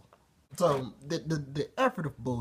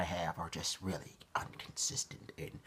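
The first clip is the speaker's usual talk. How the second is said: whisper; 6 semitones lower, 9 dB quieter than usual. Real, not acted. Whispered restart of line.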